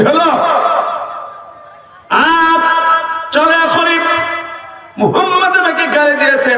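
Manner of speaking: high, ringing sermon delivery; preaching at full force. Acted. Preacher raising his voice to the crowd, fiery sermon.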